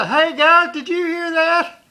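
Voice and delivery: high, loud jeering yell; teasing shout to passers-by; yelling out the window. Retold or imitated. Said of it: "he even yelled out the window".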